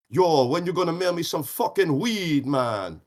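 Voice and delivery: drawn-out, high-pitched French Caribbean demand; French Caribbean caller. Retold or imitated. Imitating a friend phoning from Martinique about weed, French Caribbean accent.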